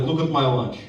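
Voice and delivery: put-on terse, low Russian accent; Russian coworker. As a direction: state it dourly with a Russian accent. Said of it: "I work with a guy from Russia".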